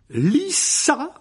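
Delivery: high, loud vicious command; nasty hiss. Acted. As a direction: spit the order out with venom. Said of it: "siffla-t-il d'un ton mauvais".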